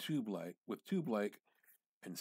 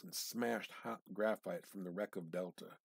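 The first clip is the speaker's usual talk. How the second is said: ordinary delivery, nothing marked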